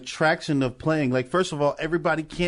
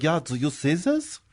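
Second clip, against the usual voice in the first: imitated German with rising question; German accent. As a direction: put on a mock German accent. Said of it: "The Germans are like".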